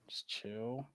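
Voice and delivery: breathy unvoiced whisper. Real, not acted. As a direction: breathe it out softly. Calm plea to players.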